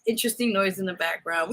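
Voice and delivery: ordinary delivery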